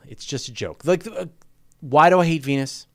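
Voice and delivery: ordinary talk, nothing marked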